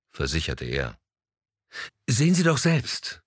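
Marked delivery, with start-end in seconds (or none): 2.1-3.2 high, loud agitated outburst; Madeleine, worked up, confronting the gallerist. A narrator's reading. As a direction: thrust the papers at him, worked up. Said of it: "erregte sich Madeleine".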